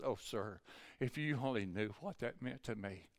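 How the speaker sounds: hushed retold gratitude; softly moved. Tearful waitress thanks the preacher for the bill, hushed imitation.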